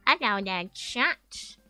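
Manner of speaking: ordinary talk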